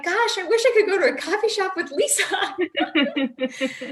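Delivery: loud, high, gushing wish, breaking into laughter; wistful exclamation. Retold or imitated. Wistful quote of herself, laughing.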